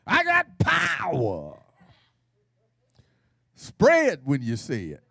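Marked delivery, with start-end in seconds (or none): 0.1-1.6 slow, higher, scolding declaration; fussing at folk. Shown as a demonstration. Congregation told to fuss at the devil, scolding. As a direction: fuss at the enemy like you mean it. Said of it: "Say it like you fussing at folk".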